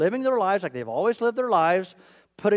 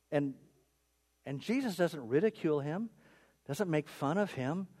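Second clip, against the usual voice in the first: usual speaking voice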